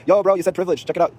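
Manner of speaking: high, loud teasing shout; calling out. Retold or imitated. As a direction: holler it across to someone by a car. Calling after man at his car.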